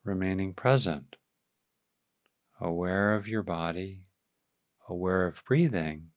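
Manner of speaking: calm, unhurried meditation voice; guided meditation. Real, not acted. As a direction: ease listeners into awareness, leaving space after each phrase.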